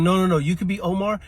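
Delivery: put-on high, loud eager child's voice; eager kid. Child eagerly pitching a game of The Wire.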